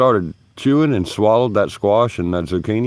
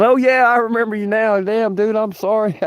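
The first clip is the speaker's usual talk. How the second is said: a much higher, lighter voice for the singer; boy-band singer impression; 13 semitones higher than usual. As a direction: play the pop star suddenly remembering you.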